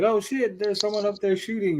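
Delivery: high, alarmed yelp of panicked troopers; startled stormtroopers. Retold or imitated. Mocking the stormtroopers shot at from above, high startled panic. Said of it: "And they're like".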